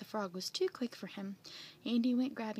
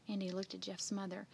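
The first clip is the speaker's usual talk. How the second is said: usual speaking voice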